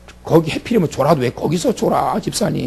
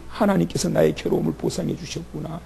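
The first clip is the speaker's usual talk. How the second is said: hushed prayer; 11 dB quieter, faster than usual. Acted. Leah's quoted thanks repeated.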